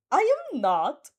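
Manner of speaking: loud, slow indignant shout; boy yells in outrage at the giggling girl; outraged yell. Acted. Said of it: "Monoma yells in outrage".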